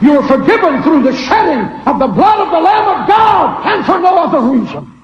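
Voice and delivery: preaching. Real, not acted. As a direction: preach it with full force. Preaching at full force.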